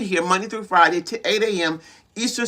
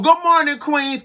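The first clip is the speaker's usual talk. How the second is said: calling out; 7 semitones higher than usual. Real, not acted. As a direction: hail the listeners with a raised voice. Hails listeners mid-declaration.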